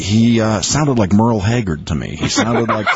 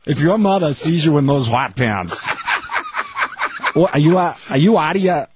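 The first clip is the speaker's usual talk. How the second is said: high-pitched Boston accent, broad O's; hammed-up Boston parent calling out and telling off; Dorchester accent; 8 semitones higher than usual. Shown as a demonstration. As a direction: scold in thick Dorchester speech. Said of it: "including the O's".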